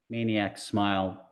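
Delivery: plain reading voice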